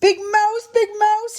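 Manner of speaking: very high small-mouse call; little mouse calling. A narrator's reading. Little Mouse waking snoring Big Mouse, high call. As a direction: call out high to wake someone. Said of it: "he called".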